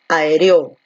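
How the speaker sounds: "aéreo" stressed on its final O; misplaced stress. Shown as a demonstration. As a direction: wrongly land on the last O of "aéreo". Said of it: "Donde la fuerza de voz recae aquí en la E".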